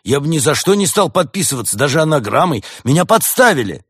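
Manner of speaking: loud, high panicked denial; frightened. Acted. Badger is suspected and denies it, frightened. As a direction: protest your innocence in alarm. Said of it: "Барсук испуганно замахал лапами".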